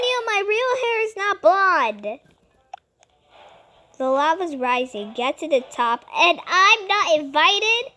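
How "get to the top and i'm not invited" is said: ordinary delivery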